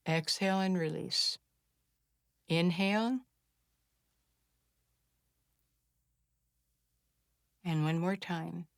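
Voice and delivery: soft, hushed breathing instructions with long pauses; guided breathing cue. Shown as a demonstration. Healer guiding viewers through a calming breath exercise, hushed.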